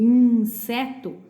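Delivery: "inseto" slowly, with a clear hissed S; strong S. Shown as a demonstration. Teacher showing why it is not Z, strong S in "inseto". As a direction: stretch "inseto", keeping the S voiceless. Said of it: "é um S forte".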